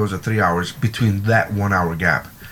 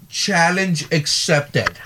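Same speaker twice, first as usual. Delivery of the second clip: mock heroic; 5 semitones higher, much slower than usual. Pushing friend aside for cookies.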